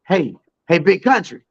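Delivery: a hint of a folksy Texan president; imitating the president.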